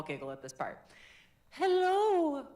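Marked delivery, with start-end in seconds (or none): 1.5-2.4 very high, loud head voice; high ringing hello. Shown as a demonstration. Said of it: "Now we're gonna find our head voice".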